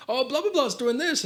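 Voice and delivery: high-pitched chatty mimicry; chatty schoolmate. Schoolmate catching the teller up on news, high mock chatter. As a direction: imitate an excited friend sharing gossip. Acted schoolmate greeting in an anecdote.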